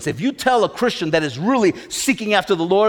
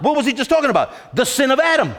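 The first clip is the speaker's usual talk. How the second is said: ordinary delivery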